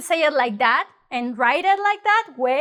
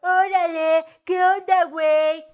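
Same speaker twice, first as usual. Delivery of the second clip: exaggerated Mexican accent, pitched higher; mocking Mexican accent; 4 semitones higher than usual. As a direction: overdo a Mexican accent to make fun. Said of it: "kind of to make fun of you".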